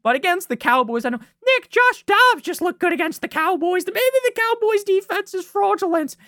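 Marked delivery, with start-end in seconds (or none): 1.4-6.2 high-pitched mock whiny fan; mock objector. Imagined listener pushing back about the Cowboys, mock high-pitched protest. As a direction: put on a high voice to parody an objector.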